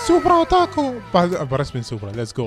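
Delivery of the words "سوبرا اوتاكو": excited anime fan. Real, not acted. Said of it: "محبين سيارات كلهم يعرفوها".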